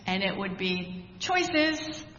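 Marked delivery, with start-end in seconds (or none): 1.2-2.1 a knowing, sing-song "Choices" pitched higher; pointed tone. Retold or imitated. Family member's pointed reply to "I'm tired", higher and knowing. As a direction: pitch it up and let it judge. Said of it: "it would be in that tone".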